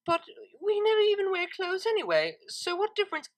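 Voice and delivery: high, rushed flustered Vinyl. Acted. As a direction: squeak it out, flustered. Embarrassed Vinyl defends herself.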